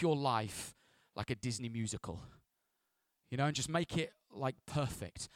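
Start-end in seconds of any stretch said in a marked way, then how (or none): none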